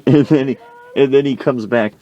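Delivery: ordinary talk, nothing marked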